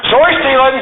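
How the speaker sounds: high-pitched, gleeful mock accusation; teasing call-out. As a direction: call a friend out in fun, pitch jumping up. Friends teasingly call each other out.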